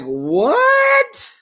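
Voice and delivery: squealed high, shocked what; real shocked squeal. Real, not acted. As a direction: shriek the word in disbelief. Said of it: "I'm like".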